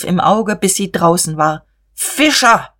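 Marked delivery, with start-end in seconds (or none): 1.9-2.7 sudden high shout of a name. A narrator's reading. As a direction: yell for the foreman. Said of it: "schrie er plötzlich". (plötzlich laut geschrien)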